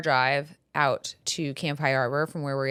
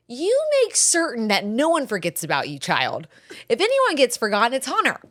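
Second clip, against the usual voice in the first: mock lecturing mom; 6 semitones higher, 3 dB louder than usual. Mom lecturing her daughter, hammed-up stern. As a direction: lecture the child with exaggerated patience. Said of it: "I was like".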